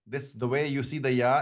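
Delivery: normal talking voice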